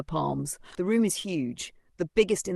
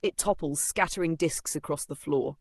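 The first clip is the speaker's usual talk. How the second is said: plain reading voice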